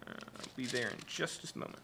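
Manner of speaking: hushed quick whisper, voice off; off-mic whisper to someone nearby; whispered aside. Real, not acted.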